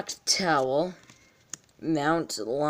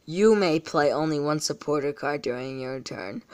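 ordinary talk, nothing marked

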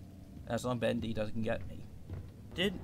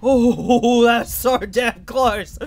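loud, slow, laughing relief; shaken laughing shout; 9 semitones higher, 14 dB louder, much slower than usual. Real, not acted. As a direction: laugh it out after a close call. Narrow escape in horror game.

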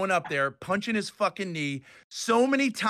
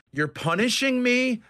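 higher and louder retold outrage; furious accusation; 7 semitones higher, 4 dB louder than usual. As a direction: throw the accusation back, indignant. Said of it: "I just got furious".

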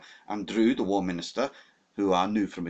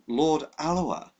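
slow, higher, clipped indignation; stiff annoyance; 8 semitones higher, 4 dB louder, slower than usual. A narrator's reading. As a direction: say it with offended pride. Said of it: "reddening with anger".

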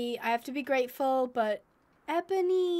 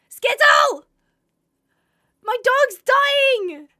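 high loud call, drawn out slowly; anguished cry; 9 semitones higher, 10 dB louder, much slower than usual. Real, not acted. Player calling to her dying dog, anguished. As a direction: cry out the dog's name in anguish.

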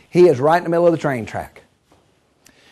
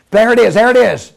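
higher, louder call; excited sighting; 4 semitones higher, 5 dB louder than usual. Acted. Man spotting the train from the plane, excited call. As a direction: call it out urgently.

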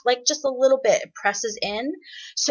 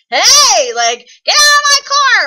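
high, loud call across the street; belting out a loud, high holler; projected street yell; 13 semitones higher, 11 dB louder than usual. Shown as a demonstration. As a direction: yell it to carry down the block. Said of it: "trying to get someone's attention across the street".

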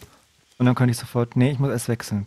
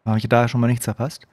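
normal talking voice

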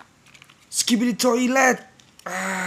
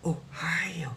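whispered Ohio; 3 semitones lower, 10 dB quieter than usual. Pretend. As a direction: breathe it out, no voice. Repeats Ohio, then whispers it.

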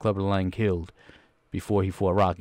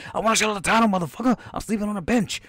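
Clubber Lang impression; 10 semitones higher than usual. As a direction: squeal it out as the hungry fighter. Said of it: "He was like".

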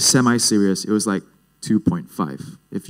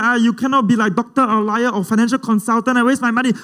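hammed-up parental tirade, pitched way up; scolding dad caricature; 12 semitones higher than usual. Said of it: "that's not really how my dad talks".

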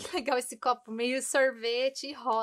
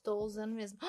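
ordinary talk

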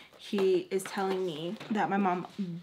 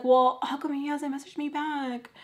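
high-pitched imitated whine; tearful whine; 7 semitones higher, 4 dB louder than usual. Crying daughter asking mom about boyfriend, whining.

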